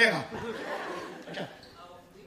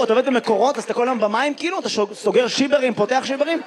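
usual speaking voice

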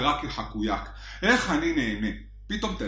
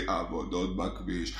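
low driver; 10 semitones lower, 9 dB quieter, slower than usual. Retold or imitated. Driver mumbling excuse.

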